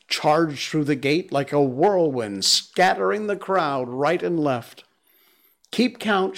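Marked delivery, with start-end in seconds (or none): 5.7-6.4 high mocking call; scornful shout. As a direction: sneer it at the crowd. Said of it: "shouted Mowgli scornfully".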